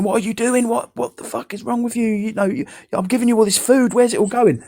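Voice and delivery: exasperated retold rant, pitched very high; losing it. Carer confronting his brother about uneaten food, high-pitched exasperation. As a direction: scold in a high, incredulous voice without shouting. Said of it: "I lost it, mate".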